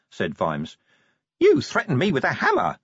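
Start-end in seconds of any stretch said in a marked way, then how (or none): 1.4-2.8 high, indignant little imp; accusing imp voice. Acted. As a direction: accuse him, high and aggrieved. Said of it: "said the imp accusingly".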